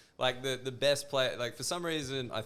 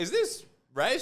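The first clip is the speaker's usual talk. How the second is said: puzzled high voice; 12 semitones higher, 4 dB louder than usual. Retold or imitated. Voicing his own puzzled thought.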